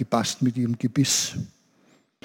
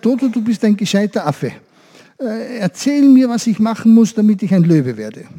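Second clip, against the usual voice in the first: pleading goat; 6 semitones higher, 3 dB louder than usual. Acted. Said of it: "und hat gesagt".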